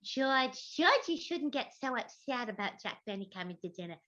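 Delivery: a lightly voiced Gracie Allen; sweet, scatterbrained radio wife; Gracie Allen impression. Asked by the host, Gracie chiding George. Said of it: "Can you do a little Gracie".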